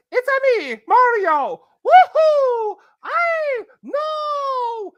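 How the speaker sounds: high, loud Mario voice with a Woohoo shout; Mario impression. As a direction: squeal it like the cheerful plumber. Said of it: "Charles Martinet says".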